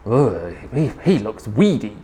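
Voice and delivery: low, disgusted grumble; grumbling. A narrator's reading. Mother T horrified by her third baby, low grumble. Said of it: "and she grumbled".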